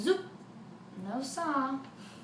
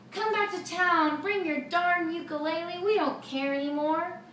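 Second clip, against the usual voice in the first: cheering crowd; 6 semitones higher, 5 dB louder than usual. Acted. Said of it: "They say".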